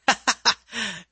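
eerie high-pitched chuckle; villain laugh. Acted. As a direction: let out a menacing laugh. Killer laughs.

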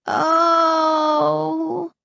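long, high, drawn-out moan; loud fake moan. A narrator's reading. Sister playing a prank on her brother, loud moaning. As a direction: moan loudly to scare him. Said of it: "I moaned loudly".

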